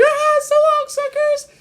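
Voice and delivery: very high squeaky taunt; helium voice. Pretend. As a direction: sound like you've sucked helium. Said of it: "the helium gets to your voice".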